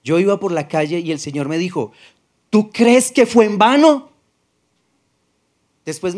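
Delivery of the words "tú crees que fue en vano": higher, louder and slow, God raising his voice; slow, booming rebuke from above; raised voice. Retold or imitated. The Lord calling to him in the street, raised voice. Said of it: "Fue la única vez que me alzó la voz".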